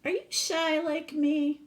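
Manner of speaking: a small girl's voice, lightly played; shy girl asking. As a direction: ask the tree hopefully like a little girl. Sylvia speaks to tree.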